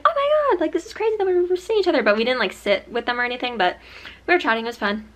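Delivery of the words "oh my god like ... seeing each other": high, loud excited gush; retold amazement. Running into friends not seen all summer, high excited amazement. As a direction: exclaim it like an amazed reunion.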